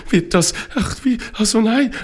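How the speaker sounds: high, jittery stammering; nervous and flustered. A narrator's reading. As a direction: dither anxiously in broken phrases. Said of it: "total aufgeregt und nervös".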